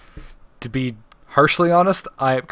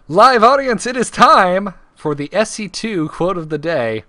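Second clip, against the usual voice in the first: raised, punched-up trivia announcer; show announcer; 3 semitones higher, 3 dB louder than usual. Acted. Host kicks off quote trivia for live audience, announcer style. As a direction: hype up the live audience for the quiz.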